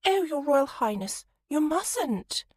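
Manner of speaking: high, breathy swooning sigh; sighing young lady. Acted. The girl swoons at Colin the prince in a high, sighing voice. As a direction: swoon over him, pitched high. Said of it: "clasped her hands together and sighed".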